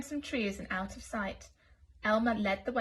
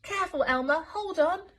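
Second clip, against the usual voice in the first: high, loud warning call; 7 semitones higher, 5 dB louder than usual. Acted. Wilbur calling a warning to Elmer. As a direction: shout a warning into the wind. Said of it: "like himself".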